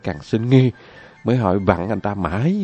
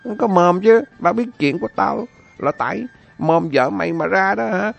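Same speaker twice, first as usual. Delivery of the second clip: master's scolding; 6 semitones higher than usual. Acted. Master scolds servant over the leaked secret, mild mock anger. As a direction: chide the servant. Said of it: "rồi mắng rằng".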